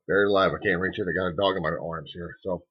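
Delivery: ordinary talk, nothing marked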